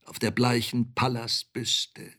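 ordinary delivery, nothing marked

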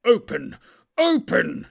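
furious commanding shout; wrathful shout. A narrator's reading. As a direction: shout at the rock in rising wrath. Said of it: "he shouted".

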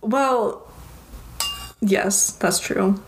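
usual speaking voice

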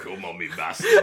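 low Nottinghamshire growl; Nottinghamshire accent. Pretend. Continues Nottinghamshire accent bit.

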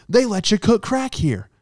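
high, loud, cheery ad voice; mock commercial testimonial. Pretend hotel ad with a guy at the stove, cheery pitch. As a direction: sell it like a proud satisfied customer. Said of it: "in charge of the commercials".

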